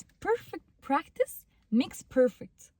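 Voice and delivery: slow, lifted emphasis on the twist; stressed reversal. Shown as a demonstration. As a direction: punch "perfect practice", then land "makes perfect".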